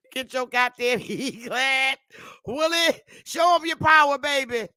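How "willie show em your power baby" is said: calling out. Real, not acted. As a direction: shout it to Willie across the room. Calling out to Willie.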